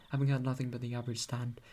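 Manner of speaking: ordinary delivery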